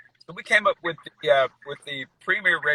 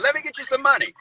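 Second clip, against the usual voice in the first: high mock voice; 12 semitones higher, 3 dB louder than usual. Son mimics himself, high far voice.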